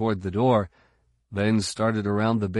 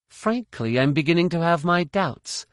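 higher, doubtful Jedi woman; young woman's voice; 8 semitones higher, 3 dB louder than usual. A narrator's reading. Jaina's voice, higher.

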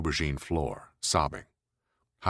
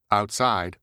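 brash woman voice; 6 semitones higher, 6 dB louder than usual. Acted. Heidi voiced.

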